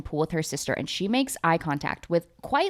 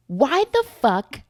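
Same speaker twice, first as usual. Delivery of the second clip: mock outrage; 7 semitones higher, 5 dB louder, slower than usual. Rants at Texas naming Paris.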